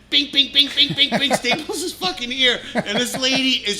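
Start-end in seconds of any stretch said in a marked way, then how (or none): 0.1-1.3 high, loud, punchy bing noises; sing-song loud stapler sound effect; mock stapler bings. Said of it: "he just goes".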